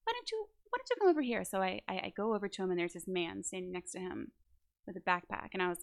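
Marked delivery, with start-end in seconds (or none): 0.0-1.4 high, fast, chirpy imitation of the director; high friendly director voice; sweet director. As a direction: mimic the overly sweet director, quick and high.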